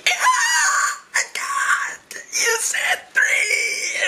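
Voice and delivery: very high, strained yell; outraged acted shriek, drawn out; pained scream. Victim tricked on the count, high pained scream. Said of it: "screaming in my pillow".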